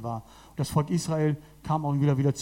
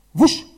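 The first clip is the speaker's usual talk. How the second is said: whoosh; 8 semitones higher, 11 dB louder than usual. Retold or imitated. Jesus suddenly appears in room.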